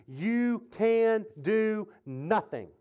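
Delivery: slow, word-by-word proclamation; deliberate, heavily weighted preaching; emphatic verse quote. Real, not acted. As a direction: space out each word down to "nothing".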